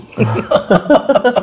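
a high, delighted laugh; happy laugh that falls away; real laugh. Real, not acted. Reading his old blog posts.

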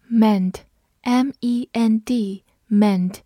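drawn-out spelling drill; slow spelling. Shown as a demonstration. Teacher spelling a word for the class, slowly. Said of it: "spelling a new word".

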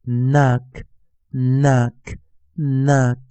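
"knock" starting on N, ending in a crisp k; silent K. Shown as a demonstration. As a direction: model "knock" slowly, first K silent. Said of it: "只发出k".

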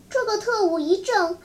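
ordinary delivery

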